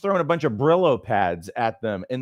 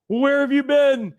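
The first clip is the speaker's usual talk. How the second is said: anxious mom; 10 semitones higher than usual. Retold or imitated. Son late home, mom meets him at door, high and alarmed.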